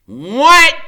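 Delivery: high, loud startled yelp; startled what. A narrator's reading. Freak startled by the teasing, high loud what. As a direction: jump at the word, high and loud. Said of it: "he goes".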